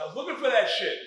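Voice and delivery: loud, high outburst; indignant shout. Retold or imitated. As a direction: yell it at the friend. Finds stolen notebook.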